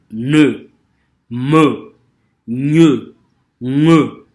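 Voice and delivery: ne, me, gne, nge said loud and nasal; teacher demonstrating the nasal letters; nasal sounds shown. Shown as a demonstration. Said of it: "tout ce qui est lettre nasale".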